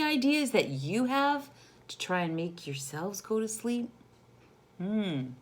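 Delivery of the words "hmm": ordinary delivery, nothing marked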